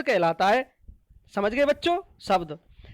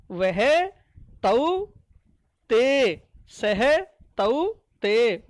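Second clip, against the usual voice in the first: slow drill; much slower than usual. Shown as a demonstration. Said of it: "drilling forms".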